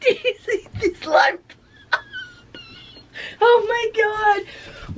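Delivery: high laughing squeal; thrilled squeal. Real, not acted. Vlogger thrilled by the light, high squeal.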